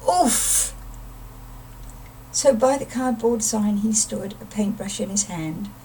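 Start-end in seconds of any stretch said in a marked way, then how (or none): 0.1-0.8 high startled grunt of a fall; pained oof. Acted. Beaky falling while skating, high startled oof. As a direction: oof as you hit the ice.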